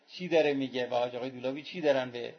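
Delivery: ordinary delivery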